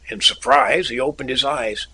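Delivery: plain reading voice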